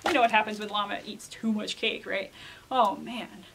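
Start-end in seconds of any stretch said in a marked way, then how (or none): none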